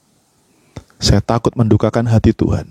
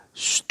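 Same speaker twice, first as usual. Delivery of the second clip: soft shushing sound; hushing shh; 12 dB quieter than usual. Retold or imitated. Preacher silencing his own acted complaint, soft shush.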